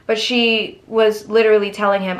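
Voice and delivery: ordinary delivery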